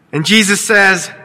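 ordinary talk, nothing marked

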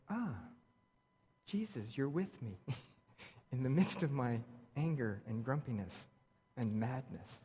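soft, low example prayer with a laugh; a gentle, lightly amused confession of grumpiness; quiet prayer. Shown as a demonstration. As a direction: say it softly to Jesus, half amused. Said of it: "as you're grumpy and angry and mad, you can say".

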